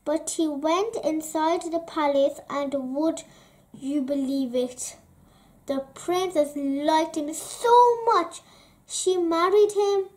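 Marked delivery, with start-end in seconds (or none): none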